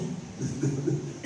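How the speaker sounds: short chuckle at himself; brief real laugh. Real, not acted. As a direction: chuckle after owning up. Laughs after admitting shame.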